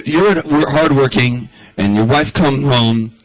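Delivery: ordinary talk, nothing marked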